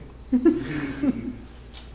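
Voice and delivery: high breathy burst of laughter; real high laugh. Real, not acted. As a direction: cackle at yourself. Laughing at his own joke.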